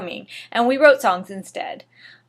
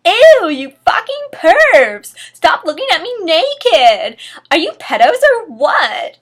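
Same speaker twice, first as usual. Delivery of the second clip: high, loud disgusted screaming; 7 semitones higher, 8 dB louder than usual. Acted. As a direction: shriek at the peeping teachers. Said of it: "I screamed".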